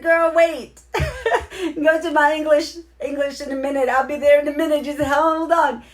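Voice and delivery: high, loud, laughing call to a friend; excited calling out. Retold or imitated. Host retells calling to Tammy in Spanish class, high laughing excited call. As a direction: squeal it out like you're waving her over.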